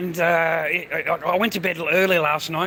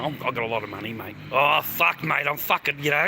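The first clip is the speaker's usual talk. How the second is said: ordinary talk, nothing marked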